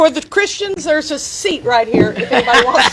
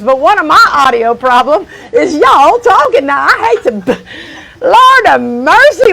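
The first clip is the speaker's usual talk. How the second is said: loud, higher, exasperated show-host outburst; scolding; 5 semitones higher, 5 dB louder than usual. Acted. Host to chattering audience, scolding loudly. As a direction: tell off a noisy crowd at full volume.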